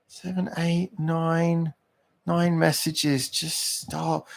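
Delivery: high, quiet, slow grumble; fed up, softly and high; weary exasperation. Real, not acted. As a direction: count them off in soft disbelief. Counting the unwanted messages.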